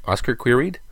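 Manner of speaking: ordinary delivery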